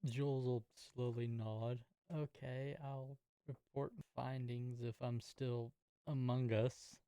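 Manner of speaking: ordinary talk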